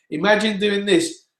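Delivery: tight, pressed, strained voice; compressed strain. Shown as a demonstration. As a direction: squeeze it like bearing down. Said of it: "on the toilet doing a poo".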